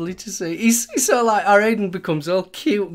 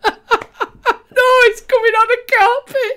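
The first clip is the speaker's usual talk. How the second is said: laughing quote; 7 dB louder, slower than usual. Retold or imitated. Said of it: "about Vic".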